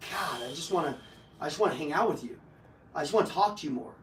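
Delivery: soft, low, dreamy longing; smitten gush. Retold or imitated. Charmed person gushing to a great listener, soft and low. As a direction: gush quietly like you're hooked.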